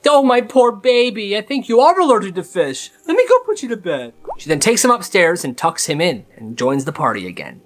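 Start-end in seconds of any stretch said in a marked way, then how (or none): 0.1-4.1 sugary mother, much higher; fake sweet mom. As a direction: switch to syrupy fake concern. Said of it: "So she flips".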